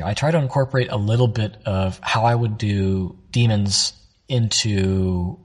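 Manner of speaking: ordinary talk, nothing marked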